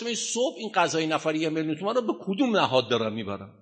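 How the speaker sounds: ordinary talk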